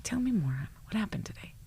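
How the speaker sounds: soft, tender prompt trailing to a whisper; gentle quiet invitation. Shown as a demonstration. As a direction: invite the husband to open up, softly. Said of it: "if I say to Aaron".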